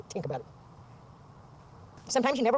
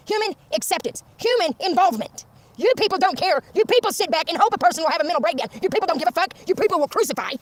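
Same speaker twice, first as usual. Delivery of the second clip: raised, higher voice, hammering each "You people"; angry rant; 4 semitones higher than usual. Real, not acted. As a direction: lash out at the people who don't care. Angry rant at religious leaders.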